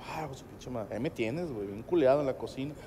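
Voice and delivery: put-on a kid cursing quietly to himself; muttered dread. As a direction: grumble it under your breath. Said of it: "Yo, thinking dread".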